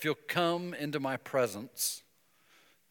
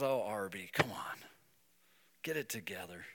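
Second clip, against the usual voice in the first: tender fatherly nudge; gentle chiding; 3 semitones lower than usual. Pretend. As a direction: gently tell him to pull it together. God's gentle reply.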